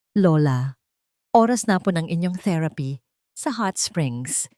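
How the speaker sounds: plain reading voice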